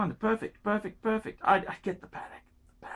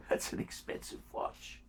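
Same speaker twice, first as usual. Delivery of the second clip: whisper; 16 dB quieter than usual. Real, not acted. Aside after price.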